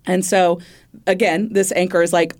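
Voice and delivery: ordinary talk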